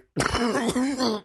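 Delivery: sudden coughing fit; real cough. Real, not acted. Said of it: "That was real".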